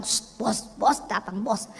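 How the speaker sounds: hushed whisper, boss is coming; whispered warning. Retold or imitated. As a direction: whisper the alarm to coworkers. Staff hush as boss arrives. (berbisik memperingatkan teman kantor)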